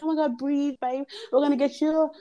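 high-pitched imitation of panicky friends; mock fussing. Friends crowd round the hurt girl, mock fussing. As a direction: fuss over her in a put-on high voice.

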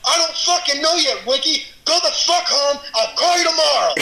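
high-pitched, irate imitation of a New Jersey boss; New Jersey accent. Boss berating two employees he has to save, angry New Jersey accent. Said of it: "You did my New Jersey accent really well".

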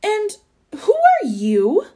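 slow, high-pitched demand; lofty challenge, swooping up high; haughty questioning. A narrator's reading. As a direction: draw out a suspicious question. Duke challenges the narrator, a stranger.

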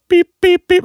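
very high imitated electronic beeps; keypad beeps. Imitating somebody typing in the door code, high beeps. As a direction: mimic a keypad in high, short peeps. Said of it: "like somebody typing in the code".

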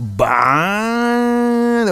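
long, high, swelling "Bah" of admiration; admiring "Bah". Shown as a demonstration. Friend hearing a new track, long high admiring "Bah". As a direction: stretch "Bah" out, high and impressed. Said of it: "Admiração".

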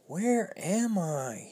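slow, hushed wonder; drawn-out, awestruck question; amazed. Acted. The thief entering the library, slow awestruck wonder. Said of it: "he said".

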